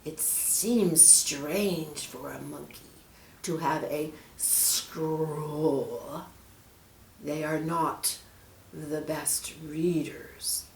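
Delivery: slow, soft snake voice; sly snake. Acted. Snake doubts the monkey in a slow hiss. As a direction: purr it out like a scheming serpent.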